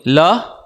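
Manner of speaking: La spoken slowly and plainly as a model sound; teaching La. Shown as a demonstration. Teacher modelling La for devotees to repeat. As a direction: say La with a clear light L, for the crowd to echo. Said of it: "Please repeat after me".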